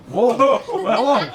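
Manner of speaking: high, drawn-out whoas with laughter; real startled whoa. Real, not acted. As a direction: laugh out a stretched, amused whoa. Reacting to a spicy pickleball rally.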